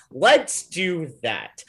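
slow, drawn-out decree; mock decisive booker. Bookers settling on a late-night idea, slow mock decisiveness. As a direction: weigh out each word like a grand idea.

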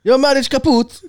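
mock Italian Mario. Acting Mario in skit.

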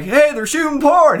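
high, loud, stretched call; imitated yell. Man at window to neighbor on the porch, mock yell. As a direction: holler down to the neighbor, playing the excited man. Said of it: "he yells down to his neighbor".

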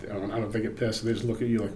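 usual speaking voice

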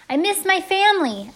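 very high, loud holler; full-out yell over the wind; shouted confession. A narrator's reading. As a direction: shout a secret at the road ahead. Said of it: "I shouted at the road".

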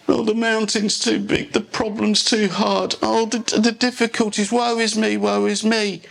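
high-pitched mock lament; mock self-pity. Preacher imitating Israelites who wouldn't go, mock woeful. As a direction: wail it lightly like a helpless complainer.

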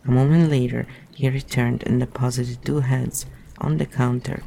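ordinary delivery

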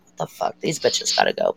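hushed, low, breathy aside to herself; whispered inner thought. Retold or imitated. College student whispering her private reaction to seat-stealers. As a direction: mutter it under your breath, annoyed.

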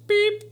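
high squeaky beep sound; imitated beep. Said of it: "I'm gonna play the beep".

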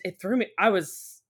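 normal talking voice

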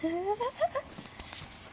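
high breathy giggle. Real, not acted. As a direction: giggle at the silly chirp. Reader laughs at own chirp.

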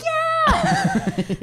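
high cheerful whoop; excited cheer. Retold or imitated. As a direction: cheer along with the table. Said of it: "and you're like".

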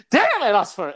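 high, loud comic frustration; mock exasperation. Client scolding the guest who keeps refusing, exasperated. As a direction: imitate a fed-up client, hammed up.

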